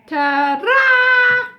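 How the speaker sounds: high, loud showman's ta-ra; fanfare shout. Acted. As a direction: announce it like a circus fanfare. Boys present the worm.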